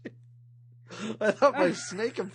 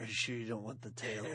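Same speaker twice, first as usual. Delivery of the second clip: Snake voice; 8 semitones lower, 9 dB quieter than usual. Pretend. Host back in Snake voice.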